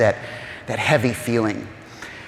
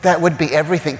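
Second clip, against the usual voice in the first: emotional excitement; 6 semitones higher than usual. Real, not acted. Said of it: "I'm getting flustered".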